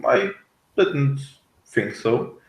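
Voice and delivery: ordinary talk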